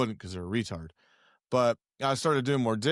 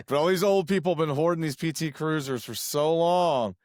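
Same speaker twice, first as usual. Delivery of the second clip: ordinary talk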